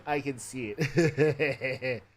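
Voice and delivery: laughing through the words. Real, not acted. Streamer laughing at chat message. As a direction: chuckle it out while reading.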